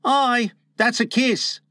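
put-on high-pitched know-it-all boy; lightly voiced boy cutting in; Slightly. A narrator's reading. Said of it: "Slightly interposed quickly".

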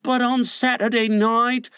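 put-on lightly insinuating boy's voice. A narrator's reading. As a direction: slip in a hint as a wheedling child. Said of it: "slightly insinuated".

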